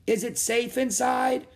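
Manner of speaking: high, slow, carrying question; calling out. A narrator's reading. Rose asks the woman about shelter, calling out. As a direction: call it slowly across the water. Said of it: "Rose called".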